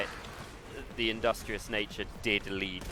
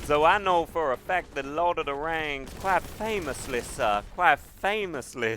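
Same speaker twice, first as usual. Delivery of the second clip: put-on Southern belle accent; 3 semitones higher than usual. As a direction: play a grand old Southern belle. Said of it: "with this Southern accent".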